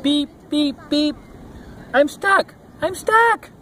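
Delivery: very high beeps and cries of alarm; squeaky-high storybook distress; little truck crying out. A narrator's reading. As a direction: cry out as a small stuck truck. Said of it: "cried the little blue truck".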